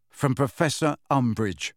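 usual speaking voice

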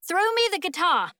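high, urgent shout; shouted demand. A narrator's reading. Boy shouts up to Rosa for her guitar, shouting. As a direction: demand it, pitched well up. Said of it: "he shouts at Rosa".

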